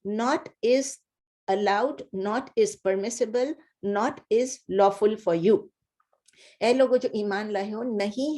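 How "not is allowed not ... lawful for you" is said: slow, word-by-word translation; teacher glossing word by word, slowly; slow literal gloss. Shown as a demonstration. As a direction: spell out each English word slowly. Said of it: "slow word-by-word translation".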